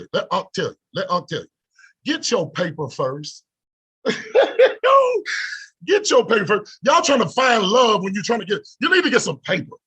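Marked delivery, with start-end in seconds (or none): 4.0-5.6 high, loud squealing laugh. Real, not acted. Laugh at his own advice.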